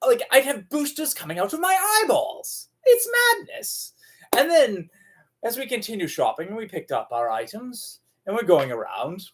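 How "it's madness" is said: high squeaky exclamation; incredulous high-pitched cry; mock exasperation. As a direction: throw your voice way up in disbelief. Exclaims over boosters.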